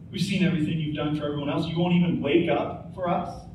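usual speaking voice

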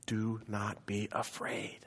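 slow hushed do not be afraid; hushed reassurance. Acted. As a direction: breathe it like a comfort. Christ's words in a vision.

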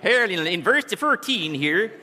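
high, loud, slow comic voice with a laugh; Carol Burnett character voice. Pretend. Pastor mocks his own stumble, loud high goofy voice. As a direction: ham up a goofy TV sketch voice. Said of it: "sounds like Mister Ted Bob, Carol Burnett".

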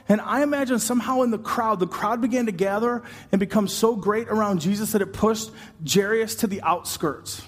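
ordinary talk, nothing marked

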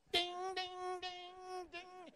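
piano plinks. Retold or imitated. Preacher imitating old Miss Ada's piano, high fading dings. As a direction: plink out piano notes, dying away. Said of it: "played the piano like a Methodist".

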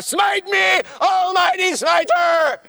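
high, loud hammed-up shout to the heavens; mock defiant cry. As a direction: dare God to smite you, hammed up.

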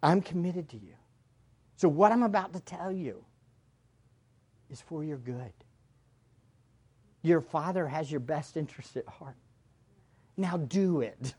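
put-on quiet, low reassurance to a child; tender fatherly talk. Preacher retelling words to his kids: hushed and tender. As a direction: speak softly, as a loving dad before a hard task. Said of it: "but I do say".

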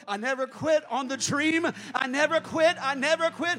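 loud, rising shouted preaching; full-voiced revival preaching; preaching at full force.

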